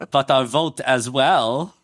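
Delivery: slow, exaggerated German accent; hammed-up German accent, rising at the end. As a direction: stretch it out in thick mock German. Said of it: "How was my German accent".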